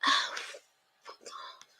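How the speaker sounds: breathless whisper under the breath; muttered whisper to herself; whispered aside. Real, not acted. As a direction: hide the curse from the mic.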